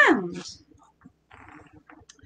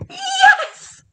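very high, drawn-out yes; excited storybook witch; witch's glad cry. A narrator's reading. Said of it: "cried the witch".

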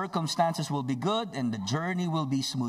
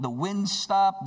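usual speaking voice

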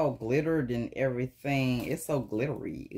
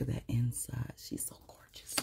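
whispering; 2 semitones lower, 9 dB quieter than usual. Real, not acted. Admiring bag inside.

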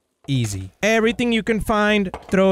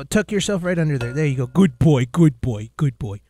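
soothing; 7 semitones lower than usual. Real, not acted.

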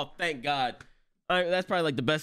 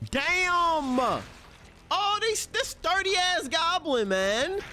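high, drawn-out disbelief; astonished outburst; much slower than usual. Real, not acted. Viewer amazed by the sturdy goblin, astonished high outburst. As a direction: exclaim high, stretching the words.

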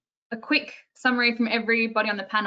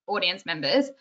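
ordinary delivery

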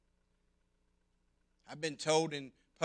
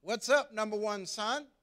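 bright, loud, higher cheery dad greeting; cheerful dad; 8 semitones higher, 7 dB louder than usual. Retold or imitated. Dad greeting his son at the back door, cheery. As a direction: call out like a jolly father coming home. Dad voiced in childhood story.

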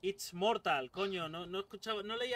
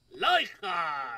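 hammed-up; 9 dB louder, much slower than usual. Hammed-up announcement.

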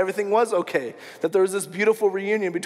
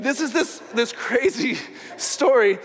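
tickled, breaking into a real laugh; amused laughing; 3 semitones higher than usual. Real, not acted. Preacher amused at the crazy story, laughing. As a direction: laugh through the words, pitched up.

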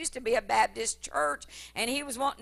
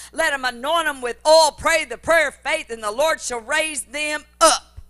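louder, higher, slowed, fervent sermon delivery; full-force preaching; 3 semitones higher, 8 dB louder than usual. Acted. Preacher rousing the congregation about healing, loud fervent preaching. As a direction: preach it out to the whole church.

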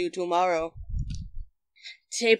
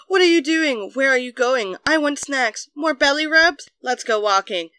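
put-on higher, eager little-kid energy; exuberant dog; 5 semitones higher than usual. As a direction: play an overexcited, needy dog. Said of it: "like four-year-olds in exuberant mood".

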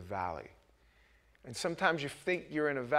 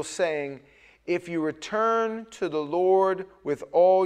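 loud, slow proclaiming read; slow, loud pulpit reading; scripture proclamation; 3 semitones higher, 8 dB louder, slower than usual. Acted. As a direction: declare the prophet's words slowly.

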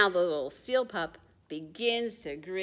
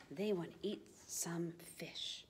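unvoiced secret whisper; 3 semitones lower, 12 dB quieter than usual. Real, not acted. Telling children the penguins' plan, whispered. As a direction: lean in and whisper it.

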